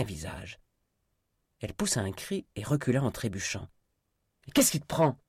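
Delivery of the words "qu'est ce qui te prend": high, loud angry demand; furious outcry. A narrator's reading. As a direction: cry out in outrage. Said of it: "s'écria Kit furieux".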